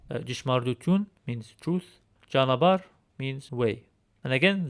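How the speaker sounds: normal talking voice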